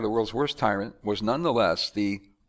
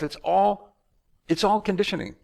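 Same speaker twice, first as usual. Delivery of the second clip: normal talking voice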